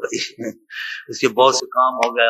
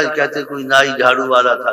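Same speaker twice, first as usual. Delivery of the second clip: ordinary talk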